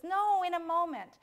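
high, loud put-off; boy calling back. Acted. Boy putting off his mother, loud high protest.

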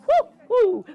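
two high, loud "whoo" hoots; playful whoops. Acted. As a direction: hoot brightly between song lines. Whoops between lines of children's song.

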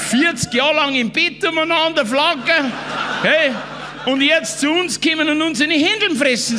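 high-pitched mock tirade; retold yell. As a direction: holler like an indignant pub ranter, imitated. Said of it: "schreit zu den Sachsen".

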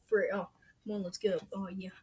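usual speaking voice

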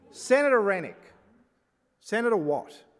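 loud stern call to order; shouting order order. Real, not acted. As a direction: call names to silence them. President calling chamber to order.

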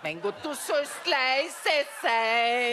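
slow, drawn-out exasperation; exasperated hushing. Acted. Wife hushing her interrupting deaf husband, slow and exasperated. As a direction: stretch out the order, fed up.

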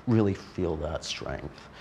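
soft, slow guiding voice. Real, not acted. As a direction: draw the feeling out gently. Guiding an imagined strength.

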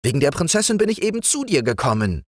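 high and fast frog prince; the prince, impatient about the princess. Acted. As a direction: rush it out eagerly. Prince speaks.

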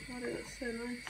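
ordinary talk, nothing marked

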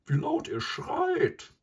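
very high, slow, loud cow voice; high-pitched, drawn-out animal character; buffalo cow. A narrator's reading. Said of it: "sagte die Kuh".

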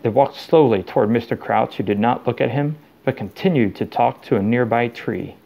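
ordinary delivery

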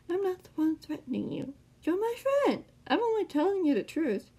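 high, put-on villain voice; taunting villain. A narrator's reading. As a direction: protest innocence in a high, theatrical voice. Man's put-on voice, protesting to Sam.

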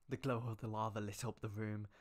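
usual speaking voice

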